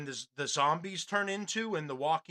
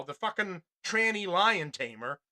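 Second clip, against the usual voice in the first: usual speaking voice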